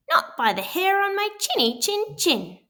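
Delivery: very high squeaky pig voice; squealing little pig. A narrator's reading. Pig refuses the wolf at the door, high squeal. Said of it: "squealed the little pig".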